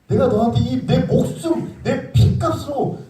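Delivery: voicing God's plea with rising passion; fervent preaching. Preacher voicing God to the youth group, fervent and slow.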